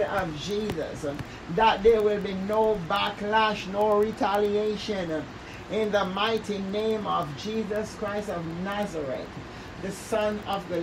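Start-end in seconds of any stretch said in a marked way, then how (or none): none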